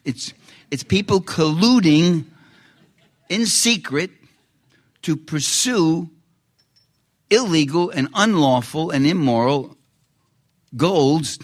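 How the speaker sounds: insisting, spelling it out deliberately; emphatic retort. Retold or imitated. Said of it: "I said".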